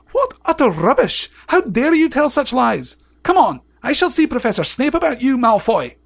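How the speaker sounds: high, loud, outraged dismissal; acted furious teacher's rebuke; shouted Professor McGonagall. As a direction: scold a lying pupil with full indignation. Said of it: "she shouted".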